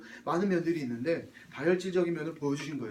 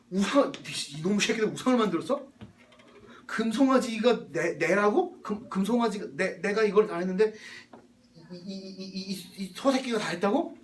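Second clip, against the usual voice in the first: loud, raised, stammering indignation; acted divine fury; 3 semitones higher, 4 dB louder than usual. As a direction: play God enraged and incredulous, sputtering with anger. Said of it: "화가 머리 끝까지 솟아올랐어요". (기가 막혀 버럭 화내는 하나님 연기)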